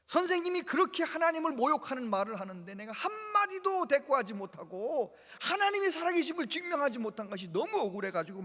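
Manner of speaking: put-on boy weeping in the rice field, upset; tearful frustration. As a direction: cry out the injustice. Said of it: "막 울었대요".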